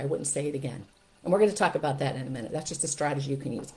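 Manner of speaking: usual speaking voice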